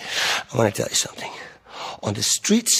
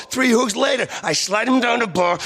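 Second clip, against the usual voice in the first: usual speaking voice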